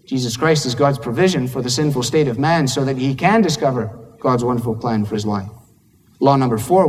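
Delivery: normal talking voice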